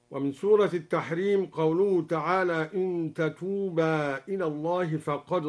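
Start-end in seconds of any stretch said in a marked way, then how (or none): none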